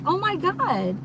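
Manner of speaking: put-on high, gushing Valley Girl "Oh my God". Shown as a demonstration.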